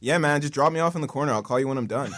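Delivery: put-on American, starting high and loud; American accent. Shown as a demonstration. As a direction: try out a casual American accent. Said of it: "my accent is definitely not good".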